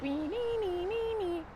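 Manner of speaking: rising nee-nee siren, voiced high; imitating a wailing ambulance siren; mock siren wail. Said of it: "they're not like".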